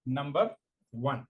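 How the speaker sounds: word-by-word dictation with long pauses; slow dictation. Real, not acted. Teacher dictating a definition to exam students, slow dictation. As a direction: dictate slowly, leaving time to write.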